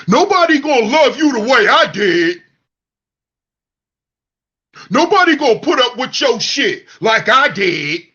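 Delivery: mocking men claiming nobody will love her; mocking bitter ex. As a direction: whine it like a sore loser. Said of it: "that's how y'all sound".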